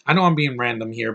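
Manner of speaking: ordinary delivery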